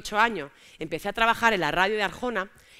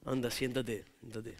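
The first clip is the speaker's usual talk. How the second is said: put-on 1 boyfriend ordering; 11 semitones lower, 11 dB quieter, slower than usual. Boyfriend voiced.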